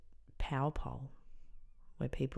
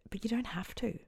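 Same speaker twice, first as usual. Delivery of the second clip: urgent whisper; 7 semitones higher than usual. Retold or imitated. Urging friend to seek help.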